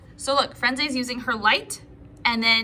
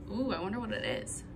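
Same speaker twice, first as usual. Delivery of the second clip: hushed wonder; 4 semitones lower, 12 dB quieter, faster than usual. Real, not acted. Hushed curious aside to kids.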